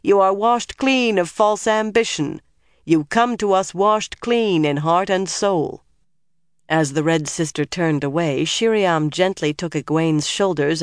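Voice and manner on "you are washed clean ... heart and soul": high, measured ritual declaration; sustained ceremonial voice; intoning. A narrator's reading. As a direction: proclaim it like a solemn rite. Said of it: "Elida intoned".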